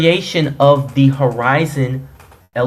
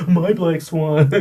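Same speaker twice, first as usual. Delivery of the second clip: mocking echo; slower than usual. Mocking repeat of opponent.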